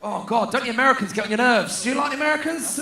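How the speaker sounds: ordinary talk, nothing marked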